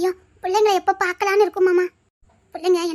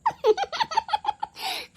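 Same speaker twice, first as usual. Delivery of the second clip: a high, mocking little laugh; teasing high giggle; 12 semitones higher, 4 dB quieter than usual. Girl laughing teasingly at the shy man's nervousness. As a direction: giggle at his shyness. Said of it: "நக்கல் பண்ணி சிரிக்காத".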